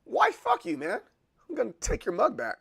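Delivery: high mock outburst at wife, then fast; joking rant at wife over a mug; mock outraged self. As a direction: squeal it in fake outrage. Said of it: "Then I was like".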